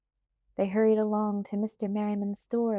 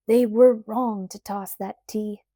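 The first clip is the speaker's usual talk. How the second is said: slow, much louder angry declaration; angry outburst; 8 dB louder, slower than usual. Acted. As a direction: bang the words out in anger. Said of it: "said the man angrily".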